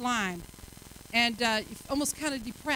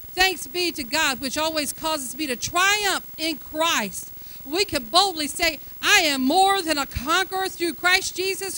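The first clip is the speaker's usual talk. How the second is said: loud, higher, climbing preacher's declaration; full-force preaching; 6 semitones higher, 8 dB louder than usual. Acted. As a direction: preach it boldly to the whole congregation.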